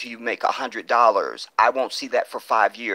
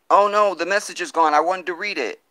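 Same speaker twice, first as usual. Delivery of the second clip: higher, louder sudden dismay; dismayed surprise; 8 semitones higher, 4 dB louder than usual. Real, not acted. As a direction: caught off guard as the comment disappears. Comment vanished mid-reading.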